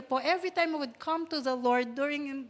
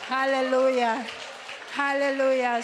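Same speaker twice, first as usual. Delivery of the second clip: louder joyful hallelujah; 2 semitones lower, 3 dB louder than usual. Real, not acted. Pastor praising God before the congregation, calling out loudly. Preacher rejoicing in worship.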